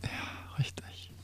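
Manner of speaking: ganz leise gehaucht; flüstere es zu dir selbst; geflüstert. Real, not acted. Leise Bestätigung beim Raten. (geflüstertes Ja, richtig)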